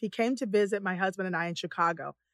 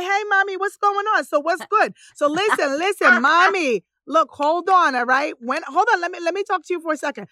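higher, louder, lively Latin-style banter; imitating a chatty Latin friend; put-on Latin flavor; 6 semitones higher, 6 dB louder than usual. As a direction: slide into a playful imitation of Latin street talk. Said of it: "so now you're Latin".

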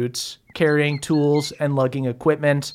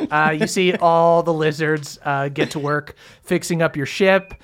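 ordinary talk

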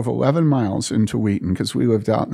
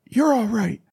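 high-pitched retold fatherly concern; urgent high acted voice; worried father; 11 semitones higher than usual. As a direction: act out a dad anxiously making sure. Retold father checks on his son.

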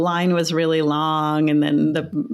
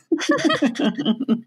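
laugh; 8 semitones higher than usual. Real, not acted. Laughing after the story.

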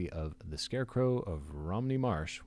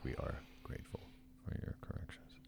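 soft whispered thanks; whispered thanks to listener after a joke; 6 semitones lower, 13 dB quieter than usual. Real, not acted. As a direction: whisper it sincerely.

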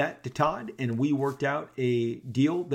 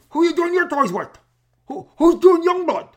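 much higher, much louder mock shout; Todd's loud demand; 11 dB louder than usual. Todd pointing at him, demanding in a loud high shout. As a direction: bark the question loudly like a hyped-up Todd. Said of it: "And Todd goes".

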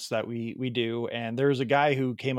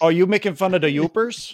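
higher, louder Midwest Wisconsin twang; mock-offended Wisconsin accent; 6 semitones higher, 5 dB louder than usual. Host challenging guest over Yoopers, in mock-offended Wisconsin accent.

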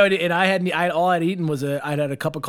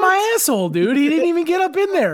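much higher, louder outrage; indignant; 11 semitones higher, 3 dB louder than usual. Real, not acted. To friends about the doctor, high-pitched indignation. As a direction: squeak it out in outrage.

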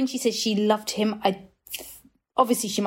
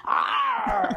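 playful growling roar; mock pirate growl; 9 semitones higher than usual. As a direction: growl like you're grabbing your prize. Growl about stealing Eddie.

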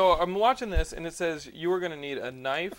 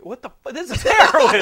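shocked shout; 8 semitones higher, 13 dB louder than usual. Real, not acted.

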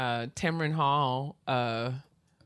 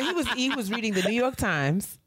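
ordinary talk

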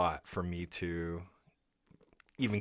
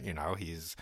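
ordinary talk

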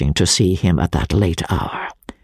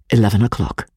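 ordinary delivery

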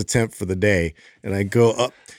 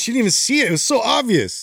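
high comic voice; 10 semitones higher than usual. Acted. Comic high telling continues.

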